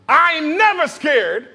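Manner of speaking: loud, high and slow with a laugh, punching never; bold declaration. Shown as a demonstration. Preacher leads the congregation in shouting the title, loud and bold. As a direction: proclaim it fearlessly. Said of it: "You gotta say it".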